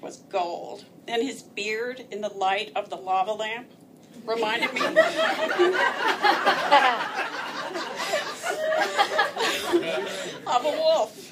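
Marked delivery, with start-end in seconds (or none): 4.8-10.4 high laugh. Real, not acted. Laughing at the memory. 10.5-11.2 laughing. Real, not acted. Laughing while telling.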